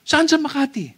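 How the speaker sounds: high-pitched, insistent question; nosy questioner. Acted. Acting a persistent questioner. (mataas ang boses, nangungulit na tanong)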